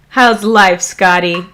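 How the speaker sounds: ordinary delivery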